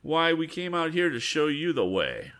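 cheeky comeback. Acted. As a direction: turn the insult back on him.

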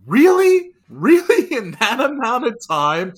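high, loud imitation of an exasperated mother; incredulous mom. Mom returning to police cars at her house, incredulous. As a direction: squeal it in mock disbelief, far above your voice.

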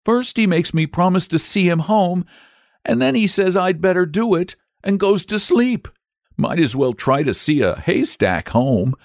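put-on high, aggrieved small boy; acted tearful child voice; tearful complaint. A narrator's reading. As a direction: pour out the grievance, close to breaking down. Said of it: "here he broke down again".

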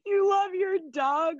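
high, slow, loud disbelieving cry; incredulous shriek. Real, not acted. Echoing her friend's crush in loud mock disbelief. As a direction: shriek it back in mock horror.

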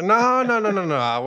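loud, high string of no's; alarmed interruption. Acted. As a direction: cut them off fast and loud before they say it. Principal cuts father off.

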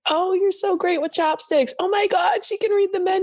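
high, gushing mimicry of strangers; sing-song patronizing praise; mock gushing compliment.

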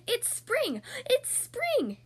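high, thrilled cheer; excited. A narrator's reading. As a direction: burst out with glee, pitched high. Duck excitedly invites Hippo out.

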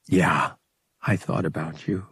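a touch of tender honesty; soft. Acted. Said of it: "he continued softly".

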